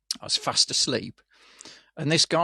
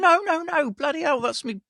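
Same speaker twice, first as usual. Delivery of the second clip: much higher, panicky no-no-no; alarmed protest; 12 semitones higher than usual. Retold or imitated. Patient to porter grabbing his drip, panicky retold yell. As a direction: stop him before he rips it out.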